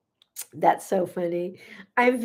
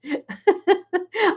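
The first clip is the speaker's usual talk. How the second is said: high, helpless real laugh; burst of laughter; 9 semitones higher than usual. Real, not acted. Host cracking up at a viewer's joke, real high laugh. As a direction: let the laugh take over. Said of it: "I'm sorry I'm laughing".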